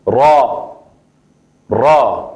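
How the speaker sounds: slow drawn-out ra, teacher demo; Arabic ra. Shown as a demonstration. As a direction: say Ra with an open a, drawn out. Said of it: "son así". (pronunciación árabe de Ra, lenta y clara)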